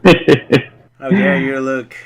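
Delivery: slow loud scolding; mock indignant. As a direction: draw it out, offended. Mock outrage at a picture.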